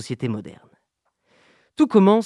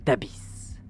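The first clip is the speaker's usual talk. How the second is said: epic fantasy narrator; 2 semitones lower than usual. Acted. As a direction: draw the listener down into the dark. Immersive deep-sea scene narration.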